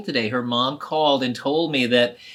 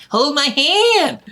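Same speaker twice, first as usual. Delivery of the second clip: much higher, louder, slow coaxing; boy coaxing; 12 semitones higher, 8 dB louder, slower than usual. Acted. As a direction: drag the words out sweetly.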